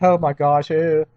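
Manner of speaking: mock disgust. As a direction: ham up the shocked parents. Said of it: "My mom and dad were all acting".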